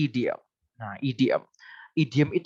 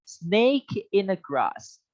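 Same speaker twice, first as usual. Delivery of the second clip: slow model reading; 6 semitones higher, much slower than usual. Shown as a demonstration. Teacher modelling the idiom slowly for Mister Vincent to repeat. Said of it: "Ayo, Mister Vincent".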